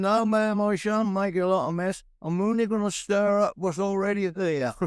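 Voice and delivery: put-on high-pitched old Suffolk boy; old country plumber. Old plumber reassuring the lady of the house, high country voice. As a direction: answer as an old rustic tradesman. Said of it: "And he's an old boy".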